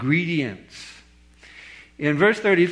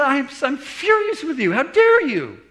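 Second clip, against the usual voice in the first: imitating an outraged man, high-pitched; livid; 10 semitones higher than usual. Angry visitor confronting the pastor at his door, acted livid. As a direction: act out indignant fury, voice raised high. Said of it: "he was livid".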